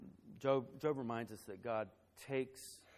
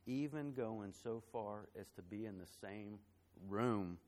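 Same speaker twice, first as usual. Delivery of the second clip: softer, holding back tears as the story lands; fighting back emotion; 3 semitones lower, 5 dB quieter than usual. Real, not acted. Pastor tells the church of friends' lost baby, softly choked up. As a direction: keep it hushed, voice close to breaking. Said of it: "gonna try to tell this story without getting emotional".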